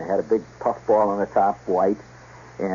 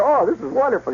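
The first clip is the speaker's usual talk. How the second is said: put-on higher, gushing kid's enthusiasm; eager boyish delight; 11 semitones higher than usual. Boy assures his doubtful mother, eagerly excited. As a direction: gush like a thrilled thirteen-year-old. Kid thrilled with Santa suit.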